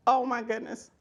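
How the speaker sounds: ordinary delivery, nothing marked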